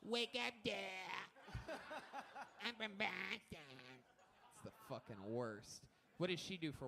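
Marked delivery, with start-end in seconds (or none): 0.0-3.8 high, half-laughing spoof of the wife; lightly voiced wife announcing she's from Boston; mock Boston wife. Wife waking her dad, a hint of a spoof.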